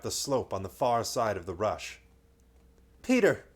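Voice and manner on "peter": high loud calls; crying out. Acted. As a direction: shout for his brother. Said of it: "cried Edmund".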